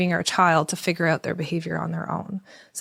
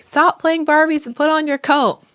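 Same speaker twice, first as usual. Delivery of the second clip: higher, louder frustrated yell; yelling at kid; 8 semitones higher, 5 dB louder than usual. Retold or imitated. Parent yelling at a child playing Barbies on the floor. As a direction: bark the order across the room. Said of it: "you yell at your kid".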